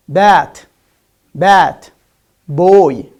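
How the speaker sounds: "bat, bat, boy" said loud, each b voiced with no air; voiced b examples. Shown as a demonstration. Teacher giving example words for the b sound, loud. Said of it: "Like you can say".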